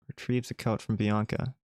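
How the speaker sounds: ordinary talk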